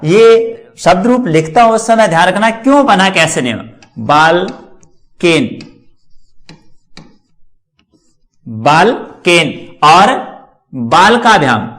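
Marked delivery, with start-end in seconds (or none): 4.1-11.7 drawn out syllable by syllable; slow teacher dictation. Shown as a demonstration. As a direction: spell it out slowly for students copying. Teacher dictating while writing.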